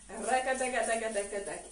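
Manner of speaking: rapid "rakataka" percussion with the mouth; rhythmic vocal rattle. Shown as a demonstration. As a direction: sound out the hip trembling beat. Teacher voices the trembling rhythm.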